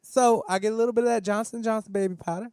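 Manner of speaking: high lisping boxer voice; squeaky, lispy champ voice; Mike Tyson voice. Acted. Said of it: "I don't really sound like him".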